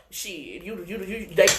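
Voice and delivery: normal talking voice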